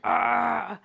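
acted groan of anger; mock angry growl. Teacher acts out "mad" for pupils, mock angry growl. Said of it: "화가 난이라는 뜻이에요".

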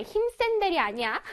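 ordinary delivery

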